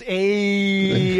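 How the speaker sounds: drawn-out catchphrase impression; Fonzie. Host imitates sitcom character, long drawn-out catchphrase. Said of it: "when Fonzie goes".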